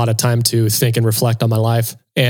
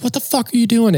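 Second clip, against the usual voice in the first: flipping out; 11 semitones higher than usual. Retold or imitated. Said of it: "I just start flipping out".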